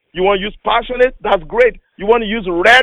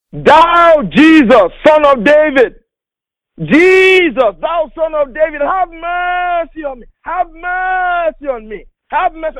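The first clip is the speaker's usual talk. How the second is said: high, pleading cry of a blind beggar; crying out for mercy; 9 semitones higher, slower than usual. Acted. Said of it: "he was crying from the heart".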